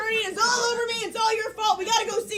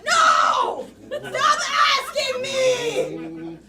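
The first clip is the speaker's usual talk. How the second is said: frantic laughing yells, higher and louder; spastic outburst; 5 semitones higher, 5 dB louder, much slower than usual. Acted. Lawyer losing it at his pushy client, frantic yelling. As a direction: flail and shout like you've lost it.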